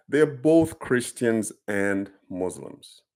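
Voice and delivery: usual speaking voice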